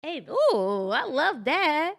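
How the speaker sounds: loud, high, drawn-out delight. Real, not acted. Guest reacting to praise that she waters, delighted. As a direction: relish it, stretching the words out high.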